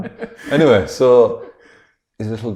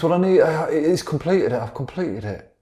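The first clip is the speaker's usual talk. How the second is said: excited scientist; 9 semitones higher than usual. Acted. Voicing excited scientist in film pitch.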